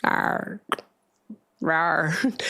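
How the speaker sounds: small pretend dinosaur growl; dinosaur roar. Host teased as sounding like a dinosaur, mock roar. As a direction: growl out a half-hearted dinosaur rawr. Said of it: "You sound like a dinosaur".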